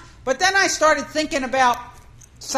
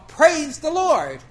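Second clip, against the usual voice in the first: praise shout; 5 semitones higher, 6 dB louder, much slower than usual. Retold or imitated. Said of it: "all the people with me are going".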